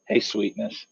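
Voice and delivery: soft, sly greeting with a grin; grinning tease. Acted. Luther teasing the hound, soft and grinning. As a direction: purr it at him with a grin. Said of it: "Luther looks at this guy and grins and goes".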